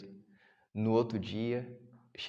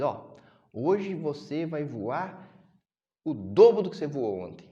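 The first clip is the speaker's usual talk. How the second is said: slow and much louder, pushing the pupil hard; emphatic loud command; 6 semitones higher, 9 dB louder than usual. Acted. Teacher bird orders the fledgling to fly twice as far, loud and slow. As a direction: drag out the words, then swell loud on the challenge.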